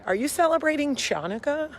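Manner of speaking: high, mock-innocent outsider's question; put-on high voice of an earnest outsider.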